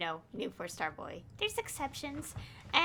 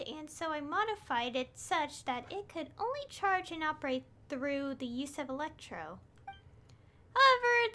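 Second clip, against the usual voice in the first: higher-pitched, measured character reading; game dialogue read-aloud; 6 semitones higher than usual. Acted. Streamer voicing an expert explaining the spike, reading aloud.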